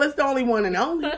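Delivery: ordinary talk, nothing marked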